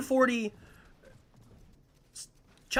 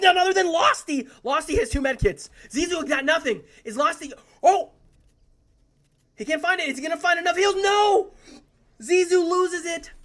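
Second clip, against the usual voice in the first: excited; 6 semitones higher, 3 dB louder than usual. Real, not acted. Caster builds a final duel.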